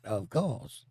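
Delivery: French-tinged reply, rising at the end; mock French accent. Host repeating a commenter's words, light mock French accent. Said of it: "she has a French accent".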